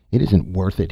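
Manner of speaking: plain reading voice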